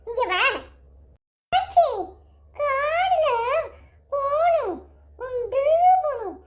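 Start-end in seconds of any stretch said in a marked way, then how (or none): 1.5-6.4 put-on childish pleading. Grandson begging to go by car.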